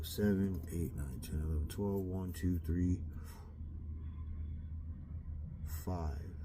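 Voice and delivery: quiet counting to himself; counting under breath. Real, not acted. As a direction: count the hours softly under your breath.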